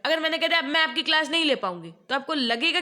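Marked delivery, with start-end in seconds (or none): 0.6-1.9 brisk, mildly cross demo; quick, firm refusal with a touch of anger; angry. Shown as a demonstration. Teacher demonstrating an angry refusal to take class, mildly cross. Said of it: "ma'am गुस्सा है".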